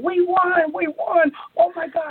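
high, elated cheer at normal volume; overjoyed, high-pitched outburst, retold; mock excited scream. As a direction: imitate a colleague screaming the good news. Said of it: "he started screaming".

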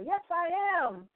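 a lightly mocking proud yes; proud mock reply. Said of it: "They said it with pride".

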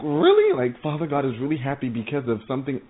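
can't believe God is happy with you; telling how she reacted to God's joy, stunned; amazed disbelief. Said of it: "I was like".

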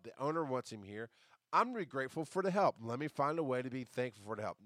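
ordinary delivery

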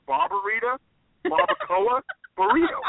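"Barbarita, barbacoa, burrito" word by word, with pauses between. Real, not acted. Naming the dish word by word.

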